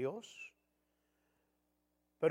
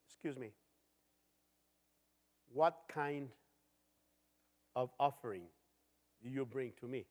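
lightly voiced stern God; 6 semitones lower, 7 dB quieter than usual. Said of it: "dice el Señor".

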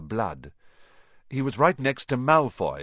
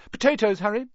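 cheerful schoolboy; 12 semitones higher, 5 dB louder, faster than usual. A narrator's reading. Percy offering food.

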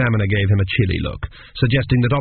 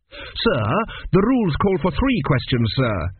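higher, polite protest; protesting student; 6 semitones higher than usual. Acted. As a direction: object politely but firmly. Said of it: "Tepic protested".

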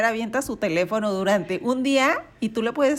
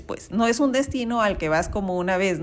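ordinary talk

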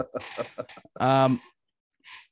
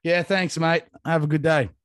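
louder and brighter, a cheery send-off; cop voice again; 5 semitones higher, 7 dB louder than usual. Retold or imitated. Mimicking the cop waving him off, loud and cheery. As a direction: wave him on like a friendly cop. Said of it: "cop again".

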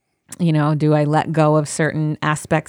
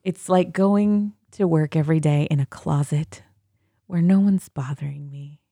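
soft, slow, hushed Zen calm; 5 dB quieter, slower than usual. Real, not acted. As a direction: speak softly, as if lulling someone to sleep. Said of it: "I was talking in my Zen voice".

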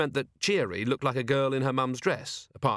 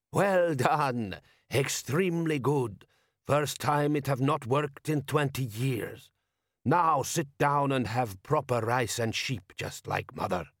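put-on slow, accented foreign elder, raised pitch; hearty desert host; 4 semitones higher, slower than usual. A narrator's reading. Wise man praises his guest after the test, hearty.

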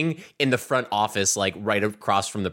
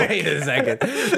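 high, drawn-out mock confusion; imagined puzzled reaction; 8 semitones higher, slower than usual. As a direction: imitate her balking, half laughing. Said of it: "she'll be like".

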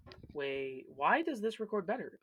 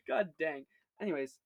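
usual speaking voice

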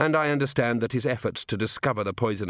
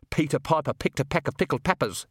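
higher, tense snarl; 4 semitones higher than usual. A narrator's reading. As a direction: snarl it, pitched up from usual. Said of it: "he snarled".

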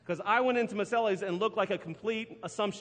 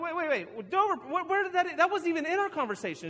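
higher, startled wait wait wait; replaying the moment it clicked, higher; retold surprise; 9 semitones higher, 4 dB louder than usual. Husband on the phone catching wife's mix-up, startled. Retelling shock at wrong restaurant.

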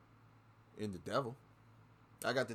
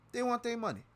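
much higher, hitting want hard; emphatic outburst; 13 semitones higher than usual. Real, not acted. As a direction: fling the repeat out in exasperation. Repeats line, much higher.

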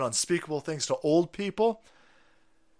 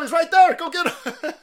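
egging on; 13 semitones higher, 7 dB louder than usual. Retold or imitated. Acted hunting call at someone.

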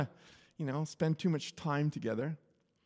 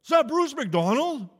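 high, loud called-out question; raised, high-pitched mock holler; retold yell; 7 semitones higher, 8 dB louder than usual. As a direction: imitate someone yelling over to you. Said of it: "someone yells".

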